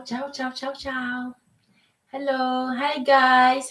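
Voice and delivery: usual speaking voice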